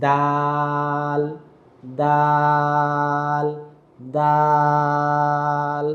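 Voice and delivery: দাল held very long on a level pitch; drawn-out letter. Shown as a demonstration. Teacher modelling the letter for learners, দাল drawn out very long. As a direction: stretch each দাল slowly and evenly. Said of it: "একইভাবে একই জায়গা থেকে".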